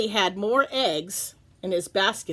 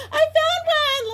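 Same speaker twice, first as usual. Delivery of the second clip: very high, slow, thrilled; excited cry; 5 dB louder than usual. Acted. Lola finding her first egg, crying out high. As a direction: cry it out proudly. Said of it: "Lola cried".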